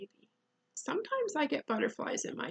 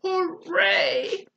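loud, higher cheer; bellowed; 6 semitones higher, 9 dB louder than usual. Acted. Bear delighted to find a picnic, bellowed. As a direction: bellow it with glee. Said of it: "he bellowed".

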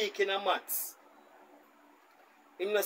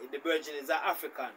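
ordinary talk, nothing marked

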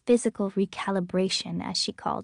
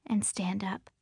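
ordinary delivery

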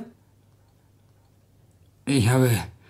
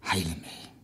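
barely audible, much softer and lower begging; a broken, faint heile mich; faint plea; 8 semitones lower, 13 dB quieter than usual. Acted. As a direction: let the plea almost die in the throat. Hiob begs the voice for healing.

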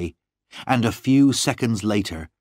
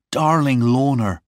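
louder, higher lover's cry; impassioned cry; 5 semitones higher, 5 dB louder than usual. A narrator's reading. John holding Lorna close, crying out to her. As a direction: cry out to a sweetheart. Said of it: "I cried".

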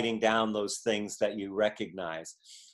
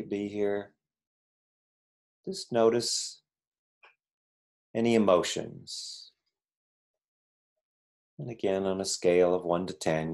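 guided meditation; 4 semitones lower than usual. Acted. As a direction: guide them gently into the body. Leads a short meditation.

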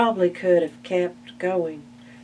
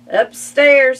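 called answer; 8 semitones higher, 12 dB louder than usual. Acted. Willie answers ducks.

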